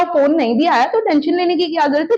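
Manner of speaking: normal talking voice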